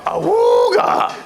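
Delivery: long high horn-like awooga; silly clown. Shown as a demonstration. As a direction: stretch it out like an old car horn. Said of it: "That's a fun one".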